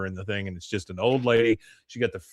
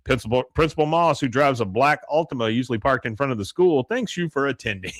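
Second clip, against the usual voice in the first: raised, projected PA-announcer voice; game announcer; 2 semitones higher than usual. Retold or imitated. Announcer closing a lost football game, mock PA voice. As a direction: call it out like a school announcer. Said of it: "and the announcer says".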